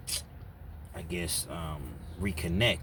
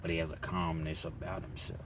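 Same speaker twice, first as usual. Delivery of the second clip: ordinary delivery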